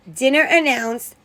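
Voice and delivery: plain reading voice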